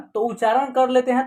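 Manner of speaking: ordinary delivery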